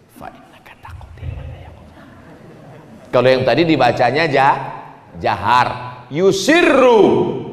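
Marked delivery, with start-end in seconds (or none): 0.1-2.0 whispered, barely audible recitation; silent-style recitation. Shown as a demonstration. Preacher showing the imam's sir voice, whispered. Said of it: "suara imam sir". 6.1-7.5 "Yusirru" stretched out high; emphatic term. Shown as a demonstration. Preacher teaching the term "Yusirru", high and drawn out.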